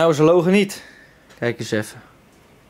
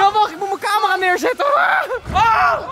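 shouting; 8 semitones higher, slower than usual. Real, not acted. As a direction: yell before the plunge. Jumping into freezing pool.

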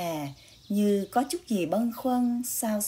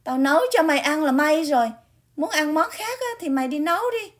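higher, louder, fast scolding sister; angry scolding; 8 semitones higher, 5 dB louder, faster than usual. Acted. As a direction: snap back at her brother. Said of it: "trừng mắt nhìn thằng Cường".

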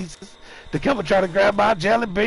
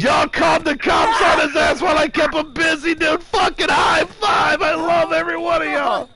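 elated shouting; 8 semitones higher than usual. Real, not acted. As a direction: cheer your teammates on. Cheers teammates for calling police.

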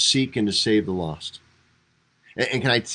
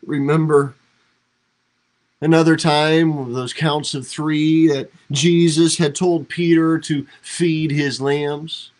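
rising preaching intensity; 6 semitones higher, 6 dB louder than usual. Acted.

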